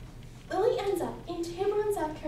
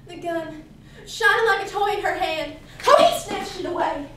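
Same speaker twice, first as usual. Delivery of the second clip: louder, with a burst on the snatch; urgent dramatic narration; 8 dB louder than usual. Acted. Daughter recalls grabbing for the gun, urgently. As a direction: relive the struggle, forceful and sudden. Reliving the struggle over gun.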